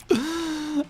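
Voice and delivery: sharp high gasp; frightened gasp. Real, not acted. Gasping in fear as brimstone nearly hits. Panic gasp during boss.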